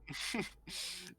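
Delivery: soft, voiceless wheezy cackle; old lady's cackle. Acted. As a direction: cackle quietly like an amused crone. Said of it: "She, she cackles".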